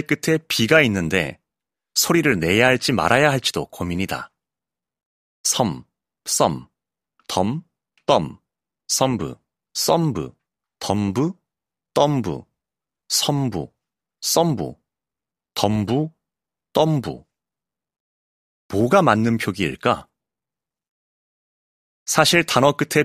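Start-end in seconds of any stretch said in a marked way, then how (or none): 5.4-17.3 "섬, 썸, 덤" each said alone as a separate candidate; variant pronunciations. Shown as a demonstration. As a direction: list variants from "섬" to "덤부", pausing after each. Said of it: "어떻게 발음하고 어떻게 표기할지가".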